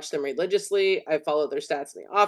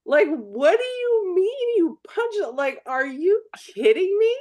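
incredulous disbelief; 12 semitones higher, slower than usual. Retold or imitated. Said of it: "I was like".

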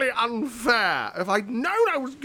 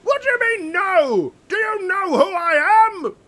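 higher, loud outrage; indignant; 9 semitones higher, 4 dB louder than usual. Acted. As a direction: demand it with royal indignation. Indignant king answers the walls.

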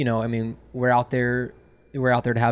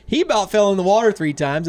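angry scolding; 8 semitones higher, 5 dB louder than usual. Retold or imitated. Captain scolding drunk clients.

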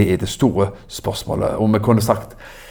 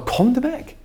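crying out; 13 semitones higher than usual. Retold or imitated. Preacher voicing Jesus calling out to the weary, high-pitched call. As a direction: call "Kom til meg" out high like an invitation across a crowd. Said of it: "Jesus sto og ropte ut".

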